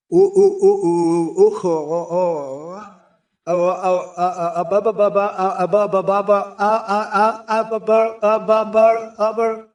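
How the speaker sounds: high hooting ooh ooh, then babbling ba ba; silly hooting noises. Acted. Mocking a chat user, high silly hooting and babbling. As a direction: hoot and babble like an animal to mock him. Mock noises after insulting.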